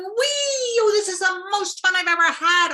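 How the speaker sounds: high, loud gleeful cry; joyful whee. Acted. Cormorant flying over the water, high and gleeful. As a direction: swoop with delight.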